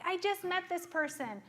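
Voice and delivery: high, eager infatuated gush; gushing client. Retold or imitated. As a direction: rave about a new crush. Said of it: "they're like".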